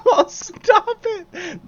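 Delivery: high squealing laugh. Real, not acted. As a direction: laugh it out, flustered. Flustered by game line.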